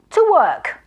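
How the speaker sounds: high, loud eager command; cried out. A narrator's reading. Magician starting his spell, loud eager cry. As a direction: launch into the work. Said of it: "he cried".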